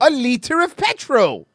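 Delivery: shouted fake British accent, pitched high; loud, exaggerated British impression; mock British accent. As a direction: yell one word like a cartoon Brit. Mock British accent word game.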